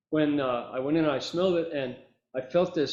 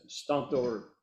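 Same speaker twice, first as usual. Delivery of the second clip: ordinary delivery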